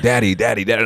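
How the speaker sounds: high, loud repeated calling; nagging toddler. Retold or imitated. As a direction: keep calling daddy like a restless toddler. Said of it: "he's just like".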